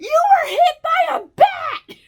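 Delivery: high laughing strangers' shout; bystanders shouting. Retold or imitated. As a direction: yell it out through laughter. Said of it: "The people behind us were like".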